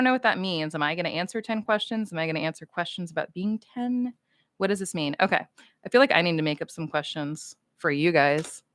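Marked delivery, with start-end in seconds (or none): none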